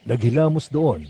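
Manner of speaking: ordinary talk, nothing marked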